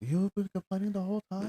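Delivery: imitating an Irish woman's complaint; mock Irish accent. A friend quotes Dempsey's complaint in a mock Irish accent. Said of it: "What accent is this?".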